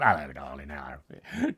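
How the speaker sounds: low, lecherous greeting voice; sleazy come-on. Retold or imitated. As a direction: croon it like a leering ghoul. Greeting girls in nightclub.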